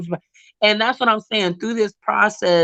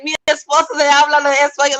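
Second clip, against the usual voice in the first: quick Spanish line; 6 semitones higher, 3 dB louder, faster than usual. Retold or imitated. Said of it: "I'm like".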